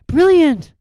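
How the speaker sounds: put-on high bright girl's exclamation; Hermione excited. A narrator's reading. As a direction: burst out eagerly as a clever schoolgirl.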